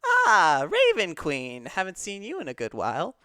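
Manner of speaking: cat greeting. Acted. Cat patron spots the Raven Queen, loud high greeting. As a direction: hail an old acquaintance with showy surprise. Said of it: "sees the Raven Queen, and is just like".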